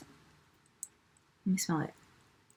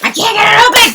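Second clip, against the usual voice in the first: frustrated shout; 7 semitones higher, 10 dB louder, faster than usual. Real, not acted. Struggling to open box.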